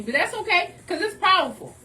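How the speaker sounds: ordinary talk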